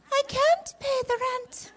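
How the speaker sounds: high wailing heroine voice; damsel in distress. Acted. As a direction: cry it out like a helpless maiden. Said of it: "So here we go".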